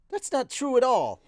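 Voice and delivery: put-on loud, high protesting girl's voice; Eris, insistent. A narrator's reading. Swordswoman contradicting her friend, insistent.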